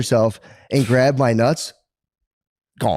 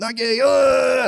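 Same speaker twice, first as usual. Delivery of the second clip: mock panic; 14 semitones higher, much slower than usual. Acting his reaction in bed.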